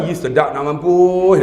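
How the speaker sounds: slow, low northern dialect drawl on mampuih; northern Malay dialect. Retold or imitated. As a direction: savour it like a Kedah in-law, slowly and low. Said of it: "dia kata".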